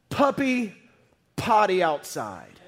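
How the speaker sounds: a touch of a stern owner ordering a puppy; playful order to the dog; mock command. Preacher joking about commanding a puppy, mock stern. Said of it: "so that you could say".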